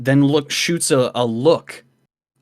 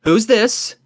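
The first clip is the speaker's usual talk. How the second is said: wary challenge; 10 semitones higher, 3 dB louder than usual. Acted. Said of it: "and says".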